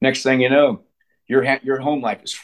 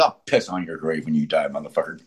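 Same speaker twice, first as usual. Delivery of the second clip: ordinary talk, nothing marked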